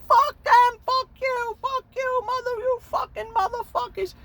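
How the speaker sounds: put-on very high, loud drunken screech; drunk yell at cops. Rhoda screaming at the cops, high screech. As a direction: scream it at the cops like a wasted idiot.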